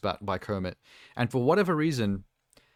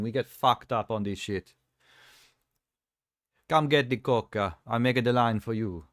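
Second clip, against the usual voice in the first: put-on Roman accent. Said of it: "it turned into Roman from G T A four".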